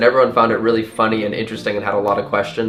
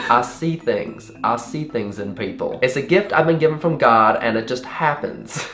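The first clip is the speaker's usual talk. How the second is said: put-on Southern accent. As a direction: imitate a mystical Southern lady. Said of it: "really bubbly and talkative and Southern".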